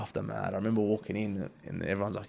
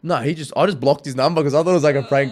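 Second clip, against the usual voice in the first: much louder and higher, rushing out the story; excited retelling; 5 semitones higher, 10 dB louder than usual. Real, not acted. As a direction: relive the panic of the prank call. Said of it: "recalls panicked moment".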